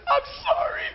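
very high wailed mock apology; acted tearful shriek of repentance; imitated bawling. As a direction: cry it out in a squeaky falsetto. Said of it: "she's bawling".

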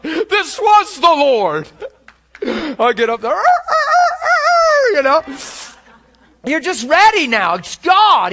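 loud, high and laughing with joy; bold excitement. Real, not acted. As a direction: burst out with confidence. Boldness comes over him.

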